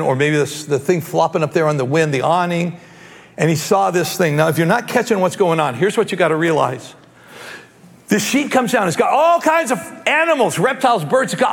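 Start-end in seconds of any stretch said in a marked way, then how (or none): none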